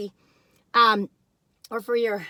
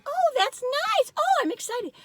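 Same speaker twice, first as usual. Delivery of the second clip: high, prim, polite show of excitement; polite restraint; 3 dB louder, slower than usual. Retold or imitated. Guest at a friend's dinner, politely restrained. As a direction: hold the thrill in politely. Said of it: "I was like".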